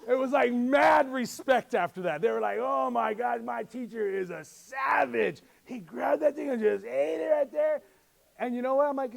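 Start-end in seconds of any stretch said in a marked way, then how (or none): 2.6-7.8 excited imitation of impressed students; hammed-up, amazed student retelling; mock awe. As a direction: gush like an amazed kid. Students' awed reaction acted out.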